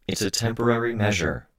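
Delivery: high, eerie voice made by insects; voice through bugs. A narrator's reading. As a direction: speak as if through a swarm. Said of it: "I spoke through my bugs".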